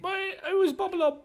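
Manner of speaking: very high, loud squeaky begging; terrified goblin. Acted. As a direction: plead for your life like a cowering goblin. Goblin blaming Bob Lob.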